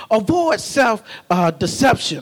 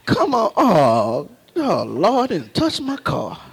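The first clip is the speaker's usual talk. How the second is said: imitating a fussy owner, softer, half-laughing; mock car owner; 3 semitones higher, 3 dB quieter than usual. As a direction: lament over a touched car. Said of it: "Some of us be mad".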